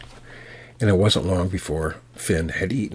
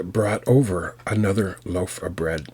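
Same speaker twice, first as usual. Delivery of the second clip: plain reading voice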